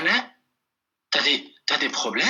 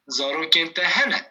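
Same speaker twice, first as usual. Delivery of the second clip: ordinary talk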